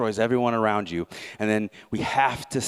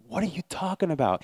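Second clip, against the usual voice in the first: higher, incredulous question; can't believe what he hears; baffled officer; 8 semitones higher, faster than usual. Retold or imitated. Officer asking the captain, higher and incredulous.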